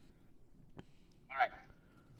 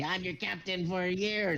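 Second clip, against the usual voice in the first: higher, slow, insistent; pompous kobold captain; 7 semitones higher, slower than usual. Acted. Kobold insisting to old man, high and drawn out. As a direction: stretch out years with a wink. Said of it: "kobold insists he's captain".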